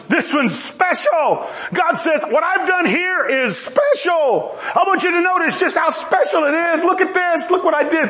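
high, proud exclaiming, drawing out key words; enthusiastic God. Acted. God showing others what he made, bursting with enthusiasm. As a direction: show off your creation with soaring enthusiasm. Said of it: "share his enthusiasm".

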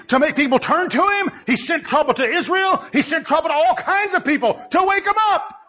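high-pitched, driving preacher delivery; leidenschaftliche Predigt. Real, not acted. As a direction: challenge the congregation with rising urgency. Preaching at full force.

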